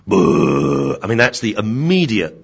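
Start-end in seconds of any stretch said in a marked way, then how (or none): 0.1-1.0 a sudden loud boo at the pup; short burst of dog hostility; mock threatening boo. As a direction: bark it out like a bristling dog. Dog's hostile reaction.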